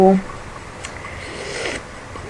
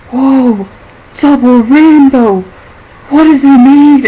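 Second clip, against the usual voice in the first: higher and louder, awed mock cry; imitating double rainbow guy; 5 semitones higher, 8 dB louder, slower than usual. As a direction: ham up the overwhelmed rainbow man, drawn out. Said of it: "his voice is amazing".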